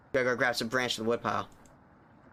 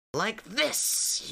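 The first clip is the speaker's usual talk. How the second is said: hissed, pitched up; 7 semitones higher than usual. Acted. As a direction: hiss it like a witch. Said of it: "you hiss".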